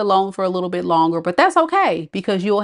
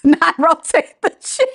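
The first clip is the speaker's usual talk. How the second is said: high words breaking through laughter; laughing; 8 semitones higher than usual. Real, not acted. Host amused by Bree's comment, laughing. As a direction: repeat it while cracking up. Cracks up at the quote.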